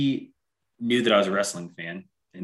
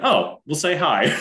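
slow and louder, laughing through it; laughing mock reply; 8 semitones higher, 5 dB louder, slower than usual. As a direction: play the wife's teasing line, laughing. Wife's teasing reply retold laughing.

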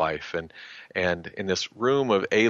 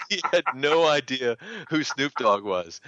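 amused; 6 semitones higher than usual. Real, not acted. Still amused right after laughing.

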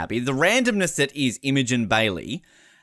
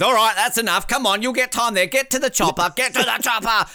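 higher, louder mock host shooing people off; imitating Dicko; 11 semitones higher, 3 dB louder than usual. Podcast host mimics the TV host ending the hugs, high and loud. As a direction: hurry the hugging families away like an impatient host. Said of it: "Dicko when he's basically".

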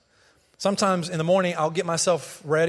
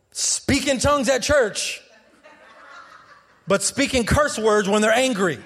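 forceful preaching; 5 semitones higher, 4 dB louder than usual. Real, not acted. Preacher urging parents to live the gospel at home, preaching forcefully. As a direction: preach hard, punching the key words.

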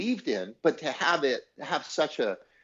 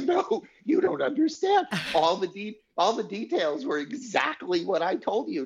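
much higher, pleading, hammed-up; mock insistent protest; 11 semitones higher than usual. To the dismissive regression therapist, a high, hammed-up insistent protest. Retelling his insistence on phone.

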